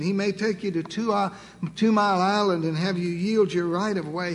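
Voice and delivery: ordinary talk, nothing marked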